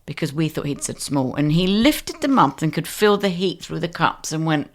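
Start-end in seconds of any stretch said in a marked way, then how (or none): none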